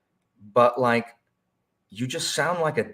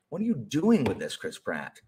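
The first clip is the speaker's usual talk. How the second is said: ordinary talk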